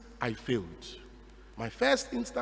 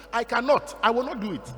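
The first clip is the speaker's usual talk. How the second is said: louder, emphatic refusal; firm refusal; 3 semitones higher, 6 dB louder than usual. Retold or imitated. Priest telling the bishop he won't, loud and firm. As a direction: put your foot down with the bishop.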